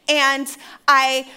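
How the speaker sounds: ordinary delivery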